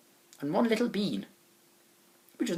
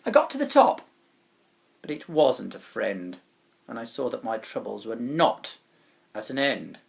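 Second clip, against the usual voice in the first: ordinary delivery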